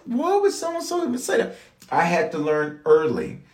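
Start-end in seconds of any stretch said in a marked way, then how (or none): 0.0-1.6 very high, thin incredulous voice; shrill squeak of wounded surprise; mock disbelief. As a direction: act baffled and offended. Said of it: "I would be like".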